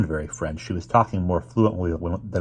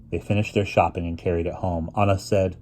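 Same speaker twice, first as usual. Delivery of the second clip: ordinary delivery, nothing marked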